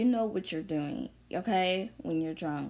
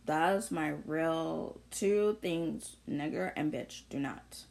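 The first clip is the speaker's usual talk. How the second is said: ordinary delivery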